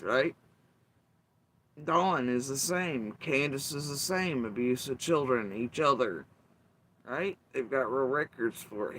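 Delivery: ordinary talk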